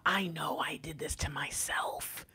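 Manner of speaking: slow, acted whisper; whispered confession. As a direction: whisper it slowly like a guilty admission. Voicing the regretful masculine's confession.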